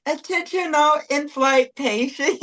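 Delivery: high, hammed-up cabin announcement voice; mock flight announcer. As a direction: play a campy flight attendant on the intercom. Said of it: "Mary to be like".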